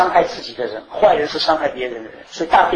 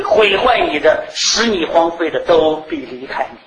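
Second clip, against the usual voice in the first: voicing God; 4 semitones higher than usual. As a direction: speak as God comforting his people. Preacher voices God's promise. (高声缓慢、恳切地扮演神的应许)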